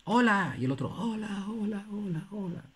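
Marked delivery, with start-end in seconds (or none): 0.0-0.6 calling out hola into a canyon; teacher's demo shout. Retold or imitated. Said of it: "Mira, grita". 0.9-2.6 high, fading echo repeats; higher and softer with every repeat. Retold or imitated. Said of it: "donde había eco".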